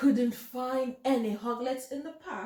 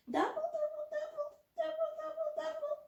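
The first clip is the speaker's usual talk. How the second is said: tiny squeaky duck voices, very high; high little quacking chant; duckling voices; 7 dB quieter, faster than usual. Acted. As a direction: pipe it like baby ducks pecking. Ducklings dabbling at the owl.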